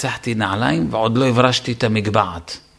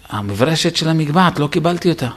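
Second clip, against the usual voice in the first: ordinary talk, nothing marked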